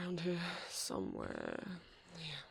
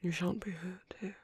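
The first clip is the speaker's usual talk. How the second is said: tender whisper. Acted. Sanctuary keeper comforting a tearful young man, tender whisper. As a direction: hush him gently like soothing a hurt child.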